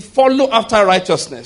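loud believing declaration; with conviction. Shown as a demonstration. Pastor urging congregation to believe, loud conviction. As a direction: proclaim it like you mean it. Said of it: "Say, like you believe it".

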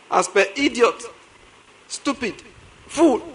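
usual speaking voice

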